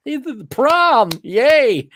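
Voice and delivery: high, loud, slow mock enthusiasm with a laugh; sarcastic cheer. Ranter mocking prom as life's peak, sarcastic high cheer.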